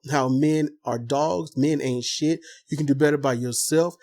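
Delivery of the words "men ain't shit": normal talking voice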